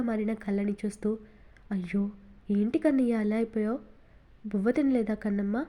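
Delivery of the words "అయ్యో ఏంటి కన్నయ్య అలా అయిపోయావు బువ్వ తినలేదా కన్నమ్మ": plain reading voice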